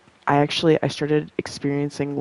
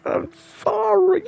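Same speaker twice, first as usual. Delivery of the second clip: high, slow, sheepish apology; pitiful apology; 4 dB louder, slower than usual. Retold or imitated. Caught texting too much, she apologizes high and slow. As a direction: whimper it like a scolded kid. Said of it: "And I was like".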